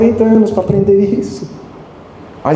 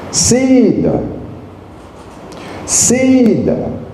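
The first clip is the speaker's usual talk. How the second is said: calling out; 6 semitones higher, much slower than usual. Retold or imitated. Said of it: "comecei a chamar".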